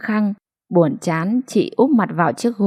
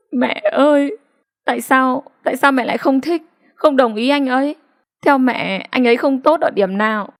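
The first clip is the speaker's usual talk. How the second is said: higher, crying plea to mother; tearful pleading; 6 semitones higher than usual. A narrator's reading. Daughter pleads with her mother about her lover, tearful and high. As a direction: beg through tears, voice pitched up. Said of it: "Chị Hạ khóc to".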